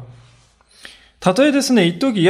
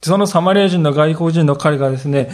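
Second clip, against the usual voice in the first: normal talking voice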